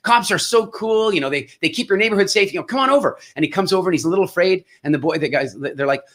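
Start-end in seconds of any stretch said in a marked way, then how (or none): 0.0-3.2 put-on talking to an eight-year-old. Reassuring a nervous boy that cops are cool, higher kid-talk. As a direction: coax a nervous boy like a kindergarten teacher. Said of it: "the whole thing that you would for an eight-year-old".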